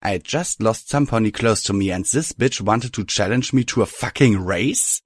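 ordinary delivery, nothing marked

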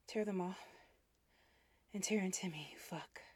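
loud, urgent, gasping plea; breathless gasp. Acted. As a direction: beg loudly, short of breath. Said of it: "I gasped".

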